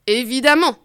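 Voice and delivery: usual speaking voice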